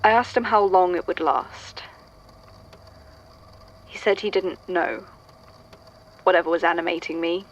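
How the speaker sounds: subdued, measured reading of a written statement. Acted. Archivist reading an old statement into the recorder, subdued.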